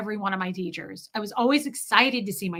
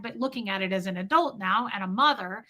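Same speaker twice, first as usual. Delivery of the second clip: normal talking voice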